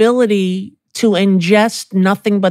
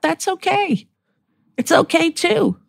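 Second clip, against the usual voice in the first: tender reassurance; 11 semitones higher, 4 dB quieter than usual. Real, not acted. Mother reassuring herself softly.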